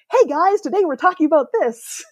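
high, bright energetic YouTuber intro. Retold or imitated. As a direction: pump it up like a YouTube host opening a video. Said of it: "high energy YouTuber voice".

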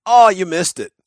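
high, loud casual call; club president's remark. Retold or imitated. Club president calling to the pilot, loud offhand. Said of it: "he goes".

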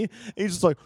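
ordinary talk

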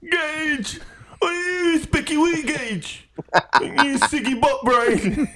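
very high, squeezed fake Scottish accent; hammed-up Scottish put-on; mock Scottish accent. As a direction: hurl silly insults in a high mock-Scottish voice. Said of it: "The accent works for everything".

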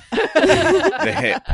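high, loud laughing shout. Real, not acted. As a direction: burst out laughing on it. Joking about popped hip.

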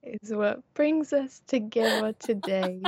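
lightly mock-solemn wedding line; a hint of a film-parody officiant; Princess Bride parody. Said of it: "sued by the Princess Bride".

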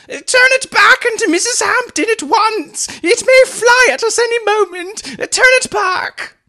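high, loud frantic plea; panicked shrill aunt. A narrator's reading. Aunt terrified of the wolf, frantic shrill plea. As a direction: beg in terror, high and shrill. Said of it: "she screamed shrilly".

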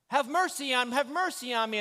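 loud, high retold plea; screaming for mercy. Blind man screaming at the crowd, loud retold plea. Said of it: "here's this man screaming".

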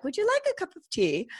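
mock British accent, high and polite. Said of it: "about to butcher her accent".

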